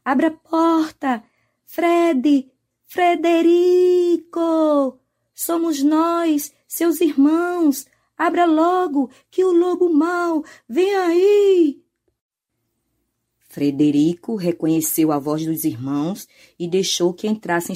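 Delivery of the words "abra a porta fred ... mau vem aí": high, loud frightened pleas; scared shouting. Acted. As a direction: cry out in panic to a brother. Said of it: "gritavam assustados".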